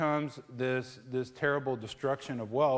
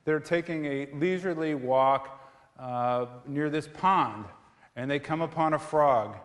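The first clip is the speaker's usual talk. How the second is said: normal talking voice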